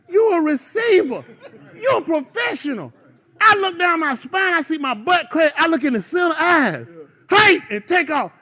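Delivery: hammed-up coach scolding a dumb receiver; mock rant. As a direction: lay into him like a fed-up coach. Coach berating receiver in retold scene.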